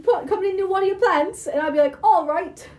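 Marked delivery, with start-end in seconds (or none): 0.0-1.5 imitating dad's put-on British, pitched up; dad's British accent. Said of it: "my dad started doing a British accent". 2.0-2.7 answering in pitched-up British put-on; mock British accent. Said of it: "everyone in my family has started doing British accents".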